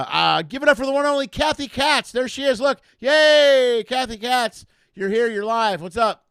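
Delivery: high, loud hype for the guest; excited host intro. Real, not acted. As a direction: bring her out like a game show host.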